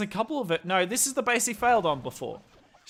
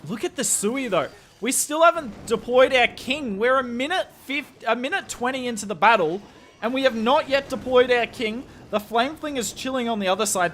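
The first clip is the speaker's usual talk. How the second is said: louder, higher caster voice; live esports play-by-play; 5 semitones higher, 6 dB louder than usual. Real, not acted. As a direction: cast the attack live with mounting energy at big moments. Casts the attack live for stream.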